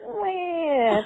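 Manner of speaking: a high, half-laughing fake sob; mock wail. Podcast host teasing her co-host about crying at Dawson, a high fake wail. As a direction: whine it like a pretend crybaby.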